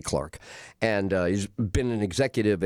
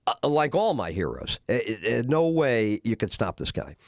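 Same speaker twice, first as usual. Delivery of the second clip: ordinary delivery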